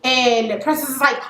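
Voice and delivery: ordinary talk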